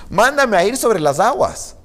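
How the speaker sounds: high, slow, play-acted plea; bold request. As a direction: play a daring disciple asking to be sent. Acts Peter's bold request. (petición osada, actuada en tono agudo)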